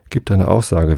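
ordinary delivery, nothing marked

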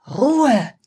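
sharp high shout. Acted. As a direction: yell it to silence the crowd. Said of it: "schreit Eva".

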